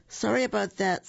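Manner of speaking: squirrel voice. Acted.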